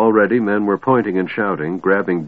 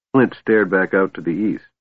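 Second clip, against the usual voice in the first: ordinary delivery, nothing marked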